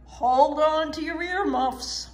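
rally them over the engine noise; calling out. A narrator's reading. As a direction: Annie warns the kids over the engine. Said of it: "she called out".

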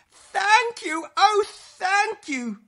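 high, loud, drawn-out thanks; overjoyed storybook gratitude; grateful cry. Acted. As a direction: gush thanks as a rescued witch. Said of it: "the grateful witch cried".

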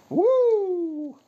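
high whooping cheer; high excited woo. Real, not acted. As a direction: let out a joyful woo.

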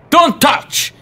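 high, loud sudden yell; sudden shout. Acted. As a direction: blurt it out as a yell. Warning at a game prop.

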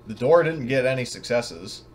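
normal talking voice

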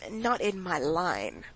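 slow, clipped English aside in a Russian talk; English phrase. A narrator's reading. Vronsky brushing off Karenin to Oblonsky, slow English aside. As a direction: drop into English, slowly and coolly. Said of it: "said Vronsky in English".

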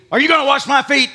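loud, sharp accusing question; loud accusing Peter; accusative. Retold or imitated. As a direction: demand it like an accusation. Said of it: "in an accusative way".

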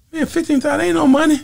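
high, dismissive scoff. Retold or imitated. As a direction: wave off the money as nothing. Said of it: "I said".

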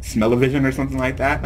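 normal talking voice

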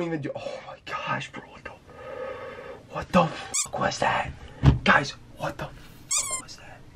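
slow, shaken whisper; hushed disbelief after a fright; scared whisper. Real, not acted. Spooked after the call.

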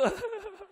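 high burst of laughter; real laugh. Real, not acted. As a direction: laugh out at the punchline. Laughing after punchline.

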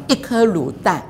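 ordinary talk

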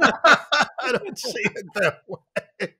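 slow, through laughter; real laughing deflection; laughing denial. Real, not acted. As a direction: drawl it out laughing.

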